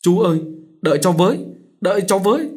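high, loud desperate calling. A narrator's reading. Girl running after the soldier, calling loudly and high. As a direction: shout after him to wait.

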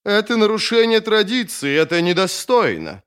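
high-pitched whiny protest; querulous whining objection. A narrator's reading. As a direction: complain in a high, plaintive voice. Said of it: "скулил Халлек".

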